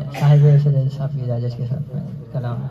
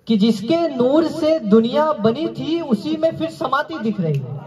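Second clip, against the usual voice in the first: lifted, lilting mushaira verse; poetry recitation; 6 semitones higher than usual. Acted. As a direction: recite it higher, with measured phrasing. Recites ghazal.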